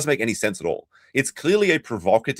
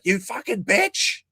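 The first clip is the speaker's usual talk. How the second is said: mock yell; 11 semitones higher, slower than usual. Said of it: "yelling down the street".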